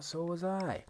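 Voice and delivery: "So was I" climbing high after a surprised "아, 진짜"; rising "So was I". Shown as a demonstration. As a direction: answer "So was I" with a bright upward lift. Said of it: "It's really, really common".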